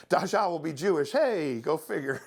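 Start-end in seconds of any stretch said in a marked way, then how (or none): none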